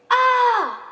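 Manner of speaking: high, loud "Ah!" scream; acted high-pitched scream; children's scream. A narrator's reading. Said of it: "The children scream".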